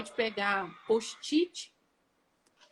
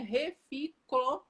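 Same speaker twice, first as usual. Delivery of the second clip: reficofage broken into slow, separate syllables; 3 semitones higher, much slower than usual. Shown as a demonstration. Teacher showing the memory phrase they learned by, slowly syllable by syllable. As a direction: spell out the mnemonic chunk by chunk. Demonstrates a memorised mnemonic word.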